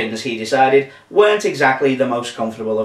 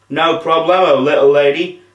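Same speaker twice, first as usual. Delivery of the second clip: louder, cheerful dude voice; beaming zombie; 2 semitones higher, 5 dB louder than usual. A narrator's reading. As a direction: beam it out as a cool zombie. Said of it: "beamed the zombie".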